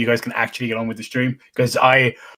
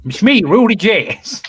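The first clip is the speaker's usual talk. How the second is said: slow, louder, higher mock Rudy intro; Rudy Jazz impression; 7 semitones higher, 5 dB louder, slower than usual. Friend introduces himself as Rudy on stream, hammed-up. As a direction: ham up a friend's stream greeting. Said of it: "you're lacking the German, like, accent".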